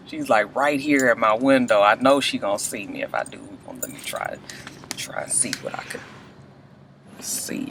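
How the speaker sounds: whisper, trying not to get caught filming; hushed whisper. Real, not acted. Vlogger filming the McDonald's girl next door, whispering. Girl parked right at her window.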